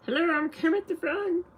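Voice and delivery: high puppet frog voice, introducing himself; Kermit impression. Shown as a demonstration.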